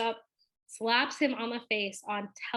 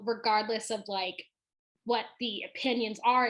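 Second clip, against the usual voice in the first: ordinary talk, nothing marked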